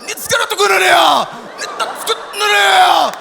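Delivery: high, loud straining mock Japanese; mock Japanese, constipated straining. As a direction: force out pretend Japanese as if badly constipated. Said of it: "ils essaient de chier".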